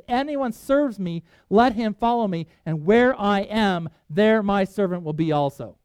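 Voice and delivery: slow, stressed preaching of each phrase; preached verse; preaching emphasis. Acted. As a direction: lean into every key word.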